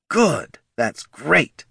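high, punchy executive voice; eager head of marketing, approving. Acted. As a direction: approve brightly like a keen marketing boss.

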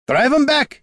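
high, loud battle cry; shouted. A narrator's reading. Gorm as the warriors attack, shouted. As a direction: shout the command to fighters. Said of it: "he shouted".

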